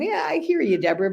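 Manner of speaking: normal talking voice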